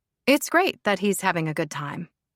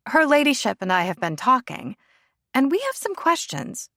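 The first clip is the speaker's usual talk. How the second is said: higher, pointed friend voice; friend Rebecca; 6 semitones higher than usual. Acted. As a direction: lead into the questions. Rebecca confronts Lila.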